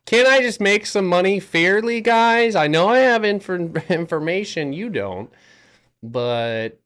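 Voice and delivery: high, loud entitled whining; whiny rich guy. Pretend. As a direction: whine like a spoiled rich man. Mock whining about rich people.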